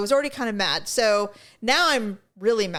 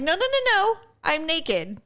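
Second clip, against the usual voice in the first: 1 frantic refusal; 8 semitones higher than usual. Retold or imitated. Refusing maintenance while naked.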